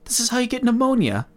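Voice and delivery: a mock sigh of a father fed up with his kid; annoyed dad. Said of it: "gets pretty clearly annoyed".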